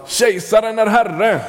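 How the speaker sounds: high-pitched proclaiming call; mock call-out. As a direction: call it out like a Roman official hailing the emperor. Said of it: "då skulle man ropa". (högt utrop som en romersk ämbetsman)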